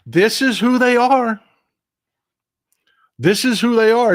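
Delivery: high, loud urgent cry; retold screaming. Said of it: "people like me that were screaming".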